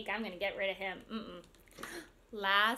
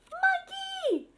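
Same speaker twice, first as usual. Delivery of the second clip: high, thrilled announcement; delighted, high-pitched reveal for little kids; excited reveal; 3 dB louder than usual. Acted. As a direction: sing out the big surprise. Reveals animal in the story.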